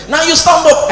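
sudden shouted order from a robber; robber barking an order. Retold or imitated. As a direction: bark it like an armed robber. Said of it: "they just look me".

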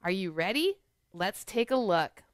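plain reading voice

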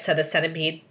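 ordinary delivery, nothing marked

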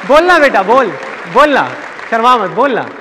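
high, loud, insistent coaxing; mimicked prodding in short, high bursts; urging relative. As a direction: push a shy child to speak up. Relatives urging shy child to speak.

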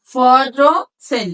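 ফটো সিন drawn out slowly, in parts; split into syllables. Shown as a demonstration. Teacher assembles the word for students, slow split ফটো সিন. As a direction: slowly build ফটো, pause, then সিন. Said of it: "সিলেবলটাকে ভাগ করতে হবে".

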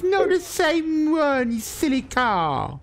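high, slow, drawn-out shriek; real angry outburst. Real, not acted. Man arguing about countries with online rival, angry shriek. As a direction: wail the denial in outrage. Heated argument over same country.

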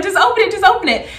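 high, eager imitation of him; excited urging. Boyfriend urging her to open the gift, excited. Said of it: "he's getting excited".

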